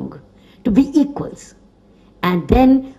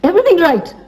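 a wry laugh through the words; chuckling as she concedes the point; 9 semitones higher, faster than usual. Real, not acted. Laughing as she admits to the audience it's impractical.

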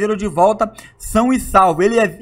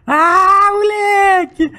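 excited; 12 semitones higher, much slower than usual. Real, not acted. Reacting to loot in chest.

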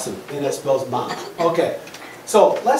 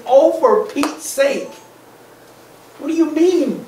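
higher, acted burst of impatience; exasperated pigeon; 7 semitones higher, 4 dB louder than usual. Pigeon protesting the duck's question, exasperated.

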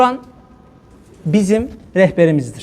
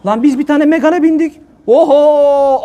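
mock outrage; 6 semitones higher, 3 dB louder, slower than usual. Mocking people scolding the preacher.

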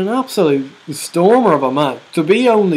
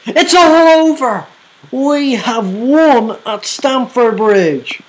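jubilant shouting; 7 semitones higher than usual. Real, not acted. Celebrating the win at Stamford Bridge, jubilant shout. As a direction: roar out the win at the whistle. Final whistle, commentary.

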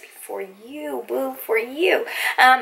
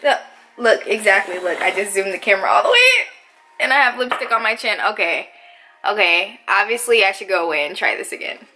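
normal talking voice

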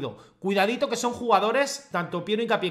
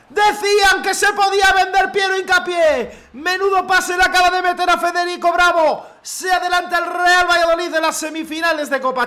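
high, loud post-goal caster call; triumphant broadcast call; excited commentary; 8 semitones higher, 6 dB louder than usual. Acted.

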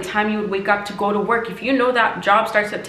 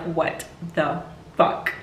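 slow, whispered disbelief; hushed, awed what the fuck; stunned whisper; 3 semitones lower, 5 dB quieter, much slower than usual. Retold or imitated. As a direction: breathe it out, dumbfounded. Shocked that visualization came true.

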